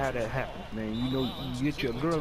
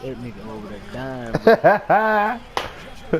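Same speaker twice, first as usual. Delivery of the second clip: loud slow call-out; 7 semitones higher, 10 dB louder, much slower than usual. Real, not acted. Yelling across room, laughing.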